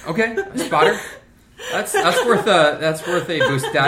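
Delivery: high laughing delivery. Real, not acted. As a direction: laugh through the words. GM amused at a player.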